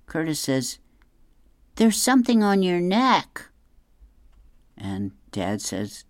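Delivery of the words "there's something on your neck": put-on high kid voice. Curtis voiced in comic.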